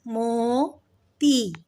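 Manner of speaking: मोती split into mo and tee, slow; drawn-out syllables. Shown as a demonstration. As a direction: stretch the o in मोती. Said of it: "ओ का sound आ रहा है".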